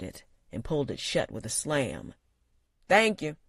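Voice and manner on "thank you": louder gruff thanks; raspy barman voice. A narrator's reading. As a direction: call it out rough. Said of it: "called the voice again".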